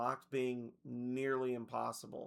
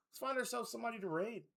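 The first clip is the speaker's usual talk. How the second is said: playful excited; 9 semitones higher, faster than usual. Real, not acted. Streamer starting a raid.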